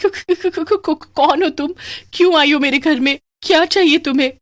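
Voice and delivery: high stammering fright; trembling fear. Acted. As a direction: stutter the questions in fear. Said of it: "डर से कांप रही थी". (काँपती डरी आवाज़)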